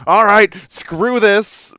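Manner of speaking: slow, high and loud, throwing up hands; fed-up exasperated outburst. Retold or imitated. Reading the list, host mock gives up in exasperation. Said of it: "And I was like".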